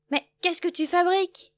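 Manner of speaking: high, loud scolding. A narrator's reading. Loup Gris scolding Loup, loud. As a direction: demand an answer.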